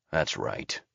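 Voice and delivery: low, soft menacing growl. A narrator's reading. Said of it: "growls Miller".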